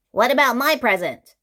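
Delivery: Mister Mean cries out for his present, fast and loud; cried out. A narrator's reading. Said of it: "cried Mister Mean".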